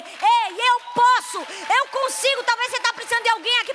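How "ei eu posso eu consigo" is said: louder, higher cry of faith; confident declaration. Shown as a demonstration. Preacher shows the congregation what to declare, loud and triumphant. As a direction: proclaim it with full belief. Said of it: "o que importa é a gente dizer".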